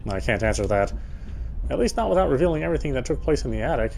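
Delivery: normal talking voice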